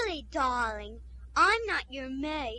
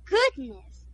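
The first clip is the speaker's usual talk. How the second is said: shocked gasp; 8 dB louder, much slower than usual. Acted. Society lady shocked.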